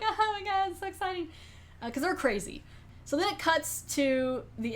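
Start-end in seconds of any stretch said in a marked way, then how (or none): 0.0-1.3 high-pitched mock glee; imitated giddy excitement. Crazy women thrilled by their chainsaw work, giddy mock excitement. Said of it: "They're laughing, they're like".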